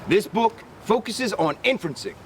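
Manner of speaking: plain reading voice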